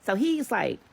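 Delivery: usual speaking voice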